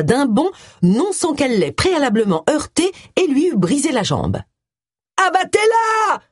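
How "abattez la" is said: very high, strained cry; shouted order. A narrator's reading. Richards orders his men to shoot Agatha, shouting. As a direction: yell the command at the top of your pitch. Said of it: "cria-t-il".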